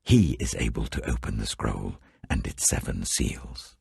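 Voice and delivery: ordinary delivery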